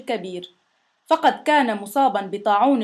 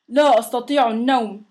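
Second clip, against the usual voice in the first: mildly moaning monster; a touch of sickbed whining. A narrator's reading. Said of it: "أن الوحش الكبير". (بأنين خفيف)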